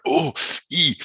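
high-pitched mock groans of effort; imitated pained groans. Injured man retells getting out of the car, groaning in mock pain. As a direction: act out stiff, achy oohs.

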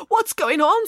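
high-pitched, flustered splutter; acted coughing alarm. A narrator's reading. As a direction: splutter through the smoke. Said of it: "spluttered Samantha".

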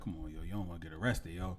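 soft, cool warning; calm him down quietly. Retold or imitated. Friend trying to be the cool one, softly warning. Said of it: "he's like".

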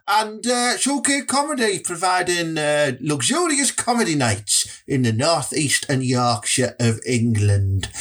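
ordinary delivery

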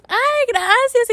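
high, slow drawn-out thanks; fake tearful thanks. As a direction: stretch it out, faking happy tears. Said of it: "Y yo". (gracias fingidas, agudas y lentas)

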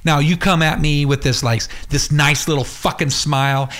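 ordinary talk

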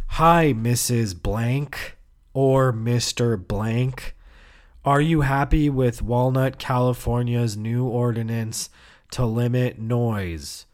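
slow, measured telesurvey script voice; robotic script reading. Retold or imitated. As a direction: recite it like a phone-survey robot. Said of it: "you're a fucking robot".

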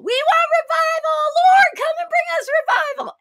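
high, loud mock plea; crying out. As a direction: imitate worshippers wailing loudly for revival. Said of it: "they would be crying out for revival".

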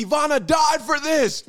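high, loud mock shout; loud mock indignation over a death; mock outrage. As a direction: yell it like fake outrage.

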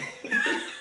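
high cackling witch laugh. Pretend. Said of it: "That's my witch laugh for Halloween".

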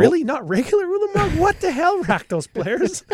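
high, loud disbelief; outraged at the card choices; incredulous. Real, not acted. Mock outrage at card list.

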